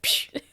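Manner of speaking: short spat "psh" gunshot noise; imitated gunshot. Fan imitating Indy versus the swordsman, gunshot noise. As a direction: mimic a quick dismissive shot.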